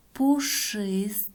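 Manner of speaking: slow word-by-word dictation; drawn out, one word at a time; dictating. Shown as a demonstration.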